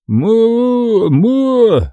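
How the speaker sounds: high, eager nasal moo; mute man's mooing. A narrator's reading. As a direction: moo eagerly without words. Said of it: "ответил он готовностью".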